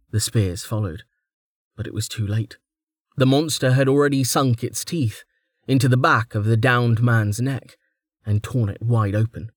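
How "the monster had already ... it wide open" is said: ordinary delivery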